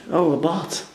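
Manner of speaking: normal talking voice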